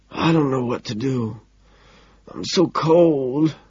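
slow, weak, rising wail; tearful despair. A narrator's reading. Homeless man clutches stranger's wrist, tearfully pleading. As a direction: plead helplessly, stretching the last words. Crying man begs for help.

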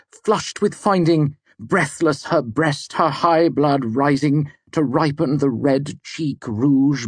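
slow, high, loud, pounding declamation; thunderous poetry recital. A narrator's reading. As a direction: pound out each beat of heroic verse. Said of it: "Elvintic poetry is thunderous. It pounds at you".

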